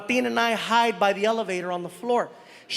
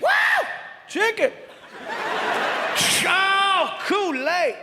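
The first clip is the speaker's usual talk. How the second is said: high, whooping delight; excited; 9 semitones higher than usual. Acted. Man unpacking a gift basket item by item, excited. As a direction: squeal over each new treasure. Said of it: "He's getting excited over every single item".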